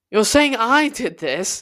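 high, strained disbelief; shrill-edged questioning; rising hysterical pitch. A narrator's reading. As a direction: voice climbing toward hysteria. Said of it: "My voice was rising to a hysterical pitch".

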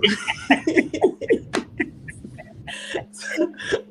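airy high-pitched laughter, real; high unvoiced laugh. Real, not acted. Laughing at her own story to the host, real airy laugh. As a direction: break into a breathless laugh.

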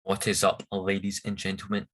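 ordinary talk, nothing marked

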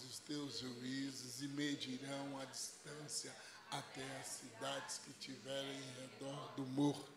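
soft, measured Bible reading; scripture reading. Acted. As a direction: read the passage aloud, steady and solemn. Reads scripture aloud. (leitura bíblica serena em voz baixa)

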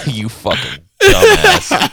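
high, slow, through laughter; real laughter breaking up the words; laughing insult. Real, not acted. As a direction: crack up while calling a friend out. Laughing at friend's silly answer.

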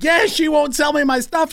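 high, tattling whine; whiny complaint. Retold or imitated. Customer complaining to the cops, high and whiny. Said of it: "the guy's like".

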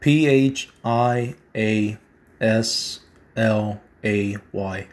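each letter of P H I A S L A Y said slowly and apart; slow, deliberate spelling of the name. Shown as a demonstration. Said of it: "Phia Slay".

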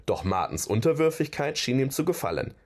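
usual speaking voice

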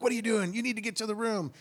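high-pitched retold demand; urgent scolding. Brother-in-law bursting in on girl, urgent scolding. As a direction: burst in with an imitated telling-off. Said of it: "bursting in the room in there".

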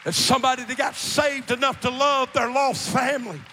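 full-voiced, driving testimony; preaching. Real, not acted. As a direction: preach with rising fervor to the congregation.

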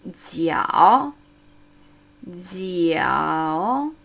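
"Jiao" with i, a and o flowing into one syllable; "Jiao" drawn out as one gliding syllable; Chinese syllable demo. Shown as a demonstration. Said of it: "Hör zu".

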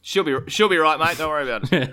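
broad, high, loud Aussie bloke; laid-back Aussie tradie voice; aussie tradesman. Pretend. Mock easygoing Aussie reply.

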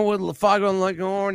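ordinary talk

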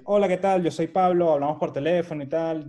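upbeat businessman introducing himself; confident greeting. Retold or imitated. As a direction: greet them boldly with nothing to hide. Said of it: "quitar la barrera yo".

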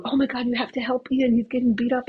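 high, mock-panicked plea; retold panic. She begs the bus driver to help Ian, high and panicky. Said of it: "And I go".

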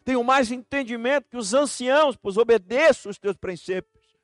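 loud, high psalm reading; proclaiming scripture. Acted. Pastor reading Psalm 119 to the church, loudly.

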